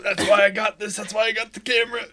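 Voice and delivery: mock realization. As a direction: act out the proud aha moment. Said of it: "I was like".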